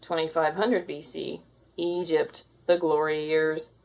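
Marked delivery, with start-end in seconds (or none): none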